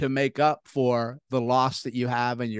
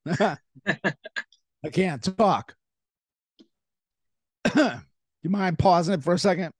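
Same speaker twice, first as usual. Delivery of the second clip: choking; 5 semitones higher than usual. Real, not acted. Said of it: "Sorry, I'm choking".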